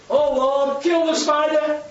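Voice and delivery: high, exclaimed mock prayer; fed-up praying lady. Lady tired of the same old prayer, high exasperated plea. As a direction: cry it out like an exasperated churchgoer. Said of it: "some little lady got a bit tired of hearing".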